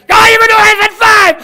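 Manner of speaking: high, full-volume drill shout; barked order. Acted. Trainer to struggling guy, barked shouting. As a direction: bellow it like a merciless instructor.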